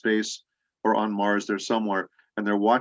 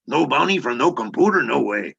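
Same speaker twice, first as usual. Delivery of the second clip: higher, louder mixed Mexican and Texan accent; 4 semitones higher, 4 dB louder than usual. Shown as a demonstration. Actor demonstrates Punch's catchphrase for interviewer, in Mexican-Texan accent. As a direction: switch accents mid-sentence, Mexican to Texan. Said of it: "I will change accents in the middle of a sentence".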